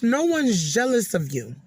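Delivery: ordinary talk, nothing marked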